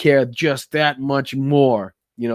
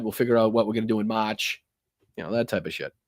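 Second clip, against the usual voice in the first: usual speaking voice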